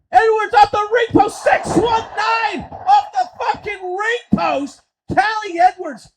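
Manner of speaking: high, shouted big-move call; thrilled wrestling commentary; excited play-by-play. Acted. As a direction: yell the signature move as it lands.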